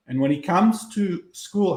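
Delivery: usual speaking voice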